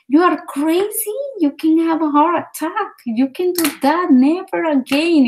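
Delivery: high-pitched imitation of an alarmed telling-off; retold scolding. Doctor warning a patient about a heart attack, imitated scolding.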